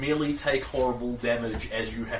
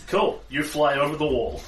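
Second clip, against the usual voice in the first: normal talking voice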